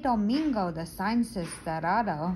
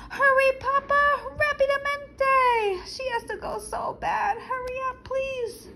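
put-on frantic child; 5 dB louder than usual. Child urges papa to hurry.

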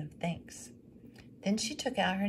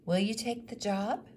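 higher, hopeful question; widow asking; 5 semitones higher than usual. Acted. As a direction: ask hopefully. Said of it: "asked the widow McDowell".

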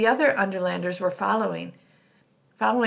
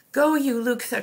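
raised, louder urgent command; cried out; 5 semitones higher, 5 dB louder than usual. A narrator's reading. Leader sending Luxa off on her bat, crying out. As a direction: call out the order to go. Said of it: "cried Solovet".

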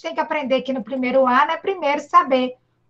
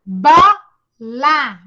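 syllables clapped; 2 semitones lower, 4 dB louder than usual. Shown as a demonstration. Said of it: "Vamos lá, nós dois".

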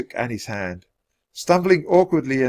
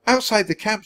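put-on high, light child's voice; little girl; 11 semitones higher than usual. A narrator's reading. Annie answering the centurion, girlish.

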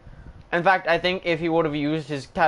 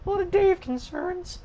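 much higher, strained interview voice; squeaky strained Dave voice, near shouting; 13 semitones higher than usual. Acted. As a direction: pitch way up into a tight, pushed wrestler voice. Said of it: "the Dave voice".

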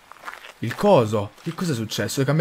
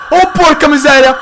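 high, loud terrified shout; panicked scream; 12 semitones higher, 10 dB louder than usual. Real, not acted. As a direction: scream in real fright. Monster attacks, panicked outburst. (grido acuto terrorizzato)

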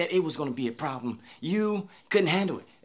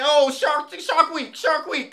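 mock panic; 10 semitones higher, much slower than usual. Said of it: "you gonna be like".

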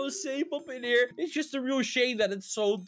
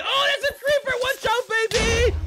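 high, loud warning cry; sudden alarm; alarmed shout; 10 semitones higher, 6 dB louder than usual. Real, not acted. Creeper appears.

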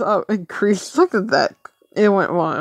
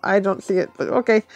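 ordinary delivery

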